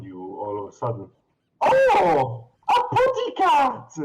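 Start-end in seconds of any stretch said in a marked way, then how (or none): 1.6-3.9 put-on very high, sugary cooing old lady. Shopkeeper lady cooing at a cat, very high and gushing. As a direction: coo over the kitty like a doting aunt.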